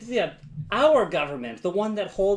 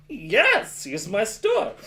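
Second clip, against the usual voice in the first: higher, louder proud clerk voice; mock proud clerk; 5 semitones higher, 6 dB louder, much slower than usual. Pretending to own Eddie Bauer, proud and louder. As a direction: announce it like a proud store owner. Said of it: "and I could be like".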